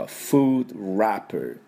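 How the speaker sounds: very slow "food wrapper" with English F and R; drawn-out teacher model of "food wrapper"; model repeat. Shown as a demonstration. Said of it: "私の後についていきましょうか".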